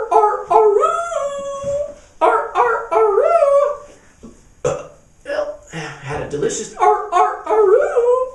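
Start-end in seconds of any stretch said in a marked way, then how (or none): 0.1-3.8 high loud wolf-like aroo; howling ringtone. Acted. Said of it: "What's that noise". 7.1-8.3 high loud wolf-like aroo; howling ringtone. Acted. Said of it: "What's that noise".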